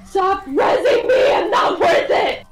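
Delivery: high, loud protest; exasperated shout. Real, not acted. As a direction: yell at the healer to stop. Yelling at healer in game.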